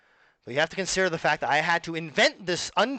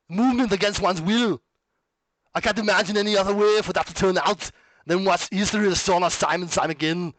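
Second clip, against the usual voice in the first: hammed-up action-star impression, higher and louder; Arnie; 4 semitones higher, 5 dB louder than usual. Shown as a demonstration. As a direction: belt it out like an Austrian strongman. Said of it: "it's not doing a good job of it anymore".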